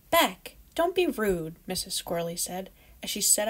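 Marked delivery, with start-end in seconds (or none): 0.1-1.6 gentle scolding. Acted. Mother scolds her child.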